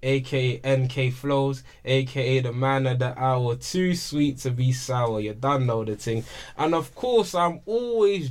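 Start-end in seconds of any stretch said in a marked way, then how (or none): 0.0-6.2 slow, low hype-man host intro; podcast host intro. Acted. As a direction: announce the show like a radio host. Podcast host's opening intro.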